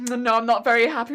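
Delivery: hammed-up moody customer; mock grumpy customer. Vlogger imitating a grumpy customer at the till. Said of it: "be like".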